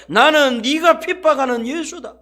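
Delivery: preacher booms the line for the church; loud proclamation. Shown as a demonstration. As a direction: proclaim 네가 핍박하는 예수다 loudly. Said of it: "따라합니다".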